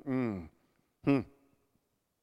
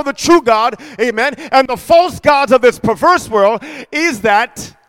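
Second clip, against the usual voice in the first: full-force preaching; 6 semitones higher, 8 dB louder than usual. Acted. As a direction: thunder the verse across the church.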